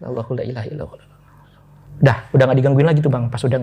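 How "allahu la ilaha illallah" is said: soft, quick murmured verse; hushed prayer recitation. Retold or imitated. Frightened passenger re-enacting Ayat Kursi while bowing, hushed recitation. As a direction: recite softly to yourself.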